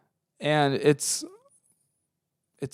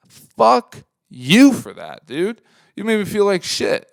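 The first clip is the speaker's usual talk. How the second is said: slow, much louder angry jab; 8 semitones higher, 8 dB louder, slower than usual. Real, not acted. Speaker telling off the guy who insulted them, slow and angry. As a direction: spit it out slowly at him.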